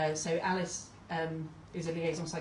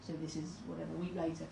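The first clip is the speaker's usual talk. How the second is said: usual speaking voice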